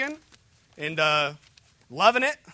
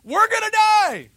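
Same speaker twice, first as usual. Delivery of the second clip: loud, high panicked cry; hammed-up shout of panic; freaking-out disciples; 13 semitones higher, 8 dB louder, slower than usual. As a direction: yell it out in mock terror, stretching the last word. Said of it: "disciples are freaking out saying".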